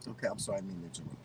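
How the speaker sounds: soft, sheepish sorry; quiet apology. Real, not acted. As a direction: drop your voice to apologize. Apologizing for interrupting co-host.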